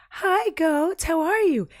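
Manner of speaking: put-on very high baby-talk greeting; cooing at goats. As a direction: coo sweetly at the little goats. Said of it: "and I was like".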